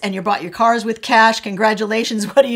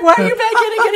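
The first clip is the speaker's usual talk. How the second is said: much higher and louder, incredulous; a startled wife asking why he's back already; exasperated surprise; 12 semitones higher, 5 dB louder, faster than usual. Retold or imitated. Wife sees husband back inside.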